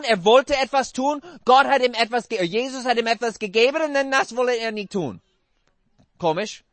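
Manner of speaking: usual speaking voice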